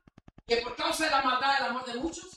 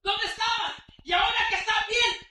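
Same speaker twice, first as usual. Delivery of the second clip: loud, high, driving sermon; preaching at full force; 9 semitones higher, 6 dB louder than usual. Acted. Preacher rebuking lukewarm believers, full-force preaching. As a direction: exhort them to come to church.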